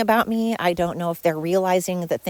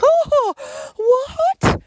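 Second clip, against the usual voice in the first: very high, loud fake gasp of outrage; mock shock; 5 dB louder than usual. Imitating the pokers who got a reaction, mock shock. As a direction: overplay their shocked reaction at the top of the voice. Mimicking their reaction after poking her.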